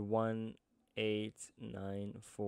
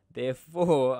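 "therefore" belted out much louder with a falling end; loud dramatic therefore; 3 semitones higher, 13 dB louder than usual. Shown as a demonstration. Teacher announcing the gross income total, loud and dramatic. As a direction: boom out "therefore" like a drumroll payoff. Builds up to the answer.

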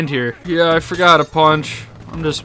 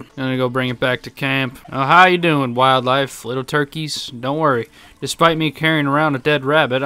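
ordinary talk

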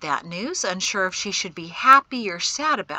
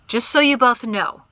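loud, raised-pitch declaration; announcing; 5 semitones higher, 11 dB louder than usual. A narrator's reading. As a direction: announce it firmly to both. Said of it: "Shem announced".

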